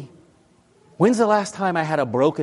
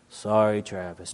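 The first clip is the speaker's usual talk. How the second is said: lower, softer, grudging mumble; reluctant apology; 7 semitones lower, 5 dB quieter than usual. Retold or imitated. Boy forced to apologize to his brother, grudging. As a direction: mumble it like a sulky kid. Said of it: "Damon, say you're sorry".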